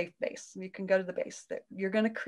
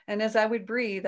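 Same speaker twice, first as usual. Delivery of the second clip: ordinary talk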